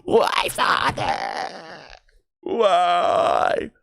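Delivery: very high whiny pleading cat voice; cat's complaint. Pretend. A cat whining at her noisy owner in a high plea. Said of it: "She's like".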